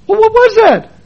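high insistent question; exasperated question. Retold or imitated. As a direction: push for an answer, baffled. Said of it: "car ride, missionary questioned".

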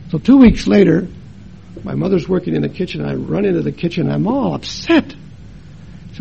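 ordinary talk, nothing marked

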